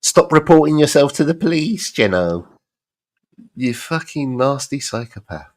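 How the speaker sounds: fast, mock schoolyard bully taunt; hammed-up bully sneer. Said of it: "bully talk".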